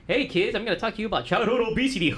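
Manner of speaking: high, laughing mascot voice; hammed-up announcer to kids; goofy mascot voice. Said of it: "Ronald McDonald coming to your school".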